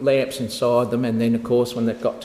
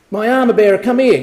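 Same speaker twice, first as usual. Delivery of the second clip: high, loud urgent cry of a wounded king; desperate Abimelech; 8 semitones higher, 7 dB louder, faster than usual. Acted. Wounded king calls his armour bearer, high and loud. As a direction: call your servant over in a panic.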